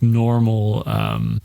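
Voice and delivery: ordinary talk, nothing marked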